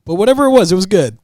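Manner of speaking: high, loud laughing rush. Real, not acted. Fan laughing while recalling Gambit joke, high and fast. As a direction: giggle through it quickly.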